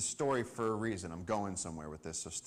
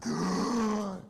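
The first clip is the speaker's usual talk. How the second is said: a sudden loud, high gasp for air; huge gasp; 10 semitones higher, 7 dB louder than usual. Retold or imitated. Freed from the coil, gasping loudly. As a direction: gulp the air back in all at once. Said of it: "took this huge breath".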